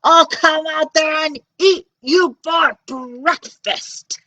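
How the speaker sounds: loud, slow giant threat; roaring giant. Acted. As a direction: roar it down the hill. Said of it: "roared the giant".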